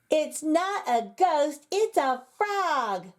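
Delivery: put-on slow, high child's voice; a lightly voiced kindergartner; little girl calling. A narrator's reading. Kindergartner Alice telling the teacher the truth, slow and high. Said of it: "called Alice".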